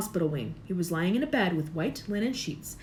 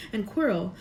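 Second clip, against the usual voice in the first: ordinary delivery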